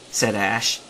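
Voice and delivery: ordinary delivery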